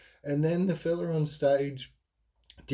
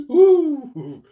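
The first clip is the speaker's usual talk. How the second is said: high, loud whooping exclaim, much higher and louder; gleeful whoop; 12 dB louder than usual. Retold or imitated. Telling listeners about the menthol feeling, loud high whooping. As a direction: hoot with delight at the fresh rush.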